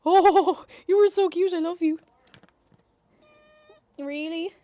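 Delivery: high, loud gushing at a cat; excited cooing. Real, not acted. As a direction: squeal with delight at the cat.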